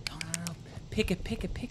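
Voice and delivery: very soft, urgent repeated prompt; off-camera prompting. Retold or imitated. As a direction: imitate a hushed tip from off camera. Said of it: "You could hear her off camera going".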